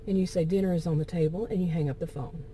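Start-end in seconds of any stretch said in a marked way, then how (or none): 0.4-1.4 brisk and calm, no anger; calm, matter-of-fact. Acted. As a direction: say it quickly and simply, without attitude. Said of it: "You just calmly say, matter of fact".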